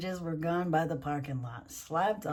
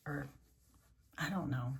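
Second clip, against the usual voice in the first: ordinary delivery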